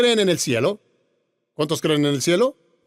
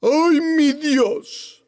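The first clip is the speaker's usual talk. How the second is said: drawn-out, high mocking "Ay, mi Dios"; scornful, sing-song "Ay, mi Dios" drawn out very slowly; 10 semitones higher, 3 dB louder, much slower than usual. Shown as a demonstration. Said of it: "Es burla".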